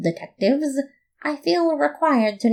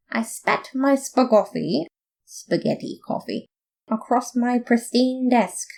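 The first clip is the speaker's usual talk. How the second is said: plain reading voice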